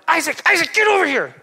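put-on yelling at a kid. Dad yelling for his son to come, loud impatient shout. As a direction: holler his name to get him over here now. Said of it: "I'm yelling".

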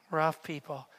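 unvoiced confiding whisper. Mary whispering to Jesus about the rough people, hushed. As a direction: breathe it like shared gossip.

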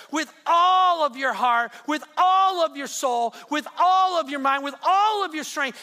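shouting each all, slow and loud; shouted commandment. Acted. Preacher repeating the commandment to the church, shouting. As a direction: proclaim every all at full voice. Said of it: "He says".

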